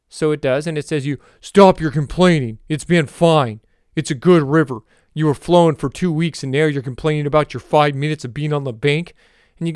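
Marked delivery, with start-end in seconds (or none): 1.4-9.1 louder, brusque mock official voice; a stern no-nonsense woman scolding, comic; Will Ferrell as Janet Reno. Talking river scolds the complainer, comic Janet Reno impression. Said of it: "Will Ferrell doing an impression of Janet Reno".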